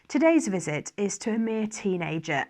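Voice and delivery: ordinary delivery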